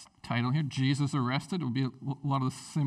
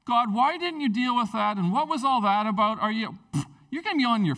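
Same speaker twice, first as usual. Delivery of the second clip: higher and louder, protesting; indignant complaint; 6 semitones higher, 4 dB louder than usual. Retold or imitated. Person facing God, complaining higher and louder. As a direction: demand answers like an offended person. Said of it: "there won't be about like".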